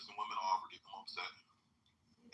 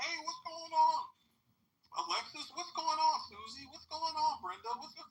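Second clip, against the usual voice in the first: high, loud mock greeting of the girlfriend's exes; guys greeting; 4 dB louder than usual. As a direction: play the friendly ex calling her name, pitched way up. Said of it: "you got all these guys coming up".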